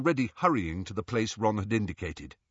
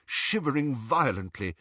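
plain reading voice

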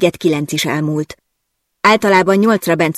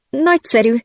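higher, strained reluctant word; forced out; 8 semitones higher than usual. Acted. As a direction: squeeze it out through reluctance. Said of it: "préseltem ki magamból". (kelletlenül kipréselve)

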